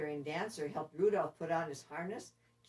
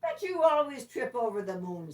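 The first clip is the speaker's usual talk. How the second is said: louder, higher taunting reindeer; mocking retort; 5 semitones higher, 7 dB louder than usual. Dancer taunts Dasher in their quarrel, louder. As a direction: jab back at him. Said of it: "said Dancer".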